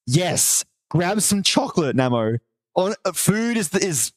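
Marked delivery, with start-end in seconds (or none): none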